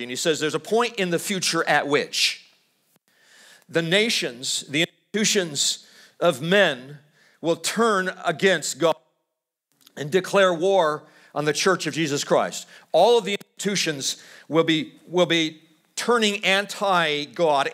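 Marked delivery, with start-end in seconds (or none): none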